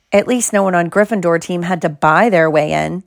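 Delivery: plain reading voice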